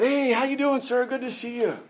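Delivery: high, eager friendly greeting; cheery greeting. Retold or imitated. Young preacher greeting an old man after the study, high and eager. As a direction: greet him like an overly sunny young preacher. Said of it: "and said".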